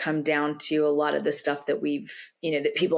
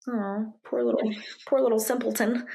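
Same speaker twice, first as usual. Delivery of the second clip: higher, sing-song mock pity; condescending; 6 semitones higher than usual. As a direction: talk down to them like a child. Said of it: "Not condescending".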